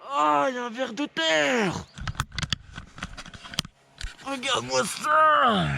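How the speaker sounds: high-pitched excited squeal; sudden delighted surprise at a worm; excited discovery. Real, not acted. Spots a worm on the ground.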